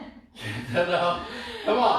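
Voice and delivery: laughing. Real, not acted. Coach laughing at partner.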